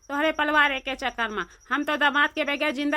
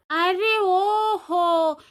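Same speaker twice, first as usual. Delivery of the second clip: calling out; 4 semitones higher, much slower than usual. Acted. Calling to crying aunty.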